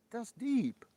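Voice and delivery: high loud mock awe. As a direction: ham up being impressed. Mock awe at deep comment.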